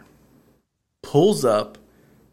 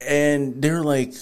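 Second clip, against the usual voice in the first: ordinary delivery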